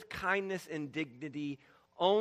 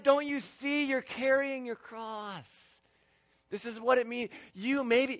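pastor encouraging; 8 semitones higher, 10 dB louder than usual. Retold or imitated. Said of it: "and I'm like".

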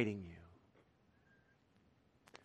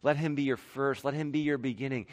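normal talking voice

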